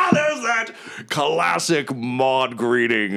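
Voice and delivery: huge high laugh into slow hearty words; boisterous adventurer. Acted. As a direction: laugh off the insult grandly. Blowhard greets old companion.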